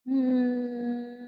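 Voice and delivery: soft low hum, lips closed; steady bee-like hum on the out-breath; humming bee sound. Shown as a demonstration. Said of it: "exhale, mm sound".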